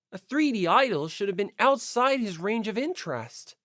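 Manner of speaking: ordinary delivery, nothing marked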